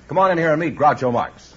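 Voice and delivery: usual speaking voice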